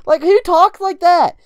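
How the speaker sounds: high, loud incredulous rant; exasperated disbelief. Real, not acted. Complaining about a voice actor's screaming, exasperated and high. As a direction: let the pitch shoot up in outraged bafflement. Ranting about an annoying character voice.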